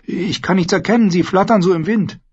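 high-pitched, nervous squire. A narrator's reading. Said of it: "wie immer äußerst nervös".

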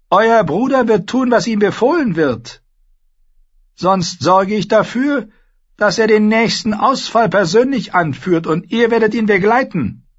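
high, loud, imperious queen voice; Cersei, haughty queen. Acted. Queen lecturing Sansa at the feast, haughty and loud. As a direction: play the proud queen lecturing the girl.

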